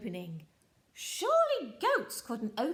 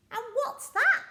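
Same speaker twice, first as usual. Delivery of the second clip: eager troll question; 13 semitones higher, 8 dB louder than usual. Acted. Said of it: "asked the Troll".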